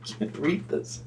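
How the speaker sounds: breathless giggling whisper; whispered through laughter. Real, not acted. Reader can't get through the sentence, giggling whisper. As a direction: whisper it while cracking up.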